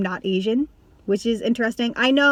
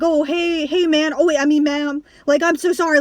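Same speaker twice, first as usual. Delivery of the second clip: higher-pitched, flustered mock apology; 6 semitones higher, 4 dB louder than usual. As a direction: act out a stranger hastily correcting themselves. Imagined stranger corrects misgendering, apologises.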